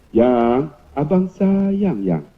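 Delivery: slow, drawn-out endearment; sweet-talking. Retold or imitated. Husband coaxing wife after forgetting her gift, slow sweet-talk. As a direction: stretch it tenderly to win her over. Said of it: "mulut dia cukup hebat".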